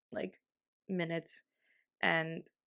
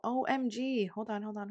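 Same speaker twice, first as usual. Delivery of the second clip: ordinary talk, nothing marked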